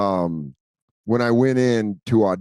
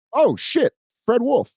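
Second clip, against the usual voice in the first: much higher, excited shock; starstruck surprise; 12 semitones higher, slower than usual. Retold or imitated. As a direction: blurt it out starstruck. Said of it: "and I go".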